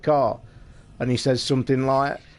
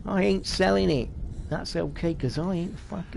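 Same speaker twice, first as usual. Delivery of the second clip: flubbed film quote; 5 semitones higher than usual. Retold or imitated. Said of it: "oh no, I've said it wrong".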